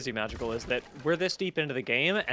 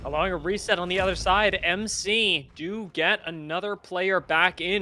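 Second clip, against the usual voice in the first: play-by-play call; 2 semitones higher, 4 dB louder than usual. Acted. Caster calls the engagement.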